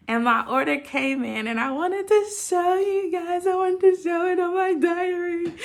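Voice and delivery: bubbling over to show it off; excited. Real, not acted. Excited to show her order.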